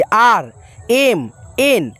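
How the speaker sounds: letters spelled out very slowly; slow, deliberate letter-by-letter spelling. Shown as a demonstration. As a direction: read out each letter separately. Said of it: "यहाँ पे आप कैसे लिखेंगे?".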